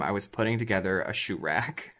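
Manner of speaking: usual speaking voice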